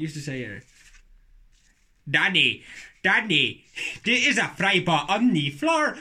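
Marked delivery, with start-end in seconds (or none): 2.1-6.0 loud, slow mock Irish-Nigerian; Irish-Nigerian accent. Shown as a demonstration. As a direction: bellow it as a comic Nigerian Irishman. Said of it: "You try doing an Irish N-, Nigerian".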